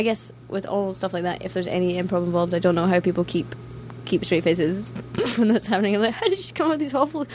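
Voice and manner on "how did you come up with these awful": higher, quick incredulous question; mock disbelief. Reacting to an improviser's awful lines, high mock disbelief. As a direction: ask it in pitched-up astonishment.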